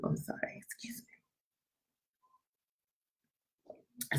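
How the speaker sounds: whispered, barely audible pardon; mock apology. Host excusing herself for being in the group, hushed mock apology. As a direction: shrink it to a faint whisper.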